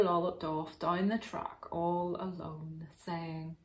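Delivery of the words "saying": usual speaking voice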